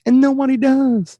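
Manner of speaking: high-pitched mock lament; mock complaint. Mock complaint about untagged photos.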